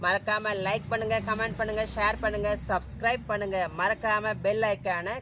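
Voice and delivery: upbeat outro address; vlog sign-off. Acted. Vlogger asking viewers to like and subscribe, upbeat.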